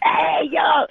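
high, loud New York firemen's "Hey, yo!"; New York accent. Retold or imitated. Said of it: "with the accent".

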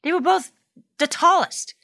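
exaggerated storyteller. Mom hypes up the story.

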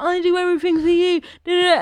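high, whiny imitation of a parent's complaint; mock hurt parent. Parent taking a joke to heart, mock hurt. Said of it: "they take it to heart".

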